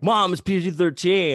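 put-on high, loud kid's plea; imitated kid pestering mom; pleading kid to mom. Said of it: "'Cause you could be like".

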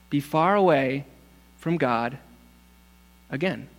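ordinary talk

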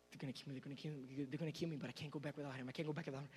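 low, hushed, very fast frantic babble; panicked muttering. Acted. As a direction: mutter it fast under your breath in dread. Acting a brother panicking before Joseph.

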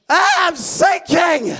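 very high, loud, slow panicked shout. Acted. Peter to Jesus while sinking, high panicked shout. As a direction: cry out as you go under.

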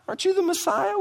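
very high-pitched mocking question; sarcastic. Shown as a demonstration. Criminal mocking the Messiah beside him, with high-pitched sarcasm. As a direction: jeer it in a squeaky-high rising voice. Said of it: "This is sarcasm".

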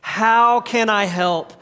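slow, deliberate unison recital; congregation recital. Shown as a demonstration. Pastor leading the church in a slow spoken response. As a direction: lead the room through it slowly. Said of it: "say it with us also".

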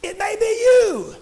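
high, shouted revival preaching; full-force preaching. Real, not acted. Preacher telling church he's after the devil, full-force preaching. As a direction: drive it home at full voice.

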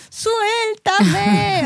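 high, sharp "let go" yelp; mock protest. Wife shakes off husband's squeezing hug with a high yelp. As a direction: squirm free of a hug. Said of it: "me quiere apachurrar y yo".

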